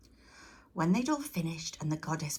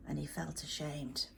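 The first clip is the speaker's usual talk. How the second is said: usual speaking voice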